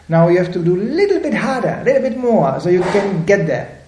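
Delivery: playfully egging someone on; mock coaxing. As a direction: coax them to keep striving. [name] urging the worker on.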